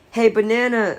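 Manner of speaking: a light jeer called up at Henry; mocking shout. A narrator's reading. Richie taunting Henry from the ladder, light mocking shout. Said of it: "Richie shouted".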